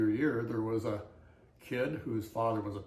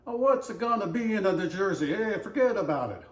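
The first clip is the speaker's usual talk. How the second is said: loud, higher New Jersey tough-guy voice, hammed-up; mock New Jersey accent; 8 semitones higher, 7 dB louder than usual. Showing how people in New Jersey talk, mock New Jersey accent. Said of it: "Now, people in New Jersey".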